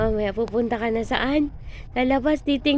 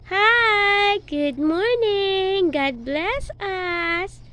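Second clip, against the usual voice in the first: slow sing-song model; 5 semitones higher, much slower than usual. Shown as a demonstration. Mother slowly models a greeting for her child to repeat. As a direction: stretch it out slowly, word by word. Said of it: "Say it".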